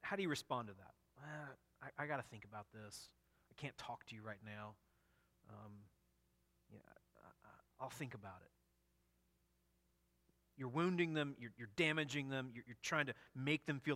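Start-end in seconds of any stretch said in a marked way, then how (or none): 1.2-8.5 quiet halting stalling, trailing to a near whisper; mumbling excuses to avoid the one who failed you; hesitant brush-off. Retold or imitated. Reply to a confessing brother with a quiet, halting brush-off.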